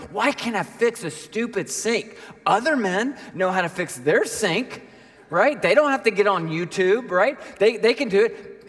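high, worked-up frustrated rant; hammed-up angry whine; acted anger. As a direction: rant in exasperation at your own failure. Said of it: "and I'm angry".

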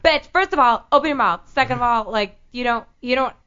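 exasperated, hammed-up lecture; acted scolding. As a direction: lay into them point by point. Acted scolding of crackly talkers.

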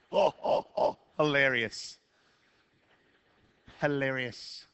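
slow, dry mock amusement; husband answers wife's teasing with a slow sarcastic hilarious. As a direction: pretend to find it funny, unimpressed. Reacting sarcastically to wife's jab.

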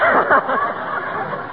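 high breathy chuckle; hearty laugh. Real, not acted. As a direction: laugh at your own joke. [name] laughs at own joke.

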